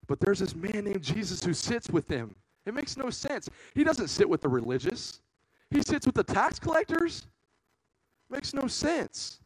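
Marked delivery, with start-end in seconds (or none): 2.6-9.4 high, ringing wonder at the idea; amazed disbelief. Real, not acted. Preacher tells the congregation it makes no sense, high amazed wonder. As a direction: preach the marvel up high, slow on the last line.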